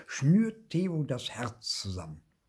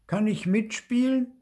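put-on high, raised child's question; pitched-up kid's request; hopeful child asking; 11 semitones higher, 4 dB louder than usual. A narrator's reading. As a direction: ask eagerly to join in.

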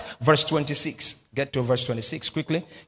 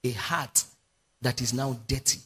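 hushed and grave; 4 semitones lower, 7 dB quieter than usual. Real, not acted. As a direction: drop to a low, soft hush. Preacher drops voice on dirty heart.

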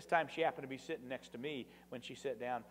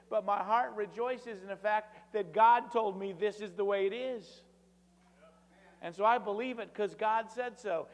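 higher, louder, earnest pulpit voice; impassioned preaching; 7 semitones higher, 5 dB louder than usual. Acted. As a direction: press the testimony home with rising fervor. Impassioned retold dialogue climax.

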